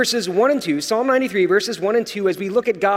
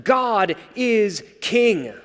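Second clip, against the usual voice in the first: ordinary delivery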